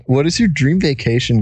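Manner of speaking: usual speaking voice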